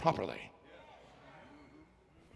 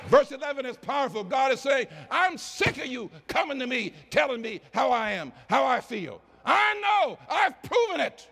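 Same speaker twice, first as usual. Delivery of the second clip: shouting God; 9 semitones higher than usual. Acted. Preacher voices God at full force.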